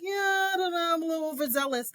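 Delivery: high, sheepish and giggly; high embarrassed shrug. Retold or imitated. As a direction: own up to being too keen. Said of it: "I was like".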